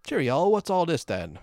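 high, loud mock British copper; British accent. Co-host teases with a mock British police accent. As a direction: put on a hammy British bobby accent. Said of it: "do this in a U K accent".

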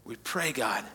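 soft, low closing prayer; quiet prayer. Acted. Pastor praying softly over the congregation, hushed. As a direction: pray it quietly and humbly.

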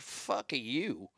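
low muttered Fuck you; blunt dismissal. Retold or imitated. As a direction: brush her off under your breath. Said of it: "I'm like".

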